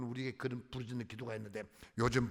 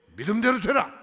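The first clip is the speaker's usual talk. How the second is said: much louder and higher, 되라 ringing out; proclaimed blessing; 6 semitones higher, 12 dB louder than usual. Acted. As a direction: declare 믿음대로 되라 at full voice. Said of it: "너 믿음대로 되라".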